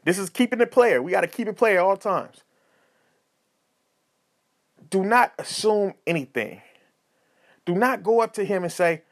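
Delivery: usual speaking voice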